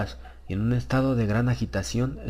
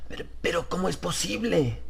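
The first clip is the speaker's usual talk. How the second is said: much higher, quivering disbelief; trembling exclamation; 9 semitones higher than usual. Acted. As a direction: still shaking with shock. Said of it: "exclamó con voz todavía temblorosa".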